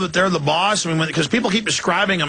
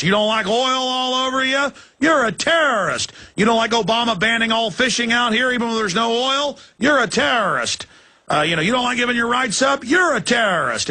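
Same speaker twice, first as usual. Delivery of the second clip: mocking thug voice; 7 semitones higher than usual. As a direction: shout it like a jeering official. Mocking thuggish officials.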